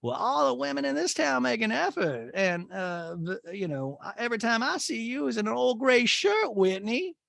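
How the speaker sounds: hammed-up imitation of a disapproving mother; Patricia. Mother scolding Whitney over his gray shirt, high mocking imitation. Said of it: "Patricia says".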